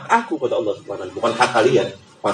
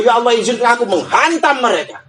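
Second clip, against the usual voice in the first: higher, urgent begging; pleading creation; 7 semitones higher than usual. Acted. Sky begging God to punish mankind, high and urgent. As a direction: plead for permission, pitched up. Sky begs to strike humans.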